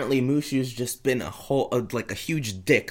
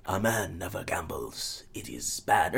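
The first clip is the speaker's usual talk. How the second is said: low, soft unvoiced whisper; hushed solemn sage; mock wise father; 7 semitones lower, 9 dB quieter than usual. Friend mocking the all-wise father, hushed. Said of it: "It's like".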